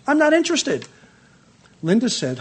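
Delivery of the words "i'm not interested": stubborn refusal, pitched high; high defiant refusal. Retold or imitated. Husband refusing the census taker, high and stubborn. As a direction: flatly shut them down.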